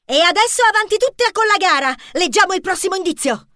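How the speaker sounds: very high, eager rallying voice; rally the team to press on; Jody eager. A narrator's reading. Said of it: "propose Jody sette". (incitamento entusiasta, molto acuto)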